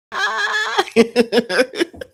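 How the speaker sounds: high-pitched squealing laugh; excited laugh. Real, not acted. Streamer seeing her screen share work, excited laugh. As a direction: burst into a high, gleeful laugh. Thrilled that screen sharing works.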